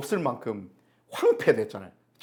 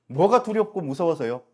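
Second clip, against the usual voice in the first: ordinary talk, nothing marked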